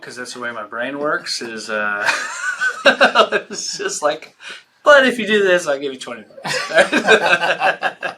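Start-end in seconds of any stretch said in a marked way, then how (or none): 2.1-3.5 high unvoiced burst of laughter; breathless giggle. Real, not acted. Guest cracking up at his own joke, breathless giggle. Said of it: "my intrusive thought of a joke". 4.8-8.1 high, loud mock dad voice, laughing through it; joking bribe. Guest joking as a father bribing his son, laughing. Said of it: "my intrusive thought of a joke".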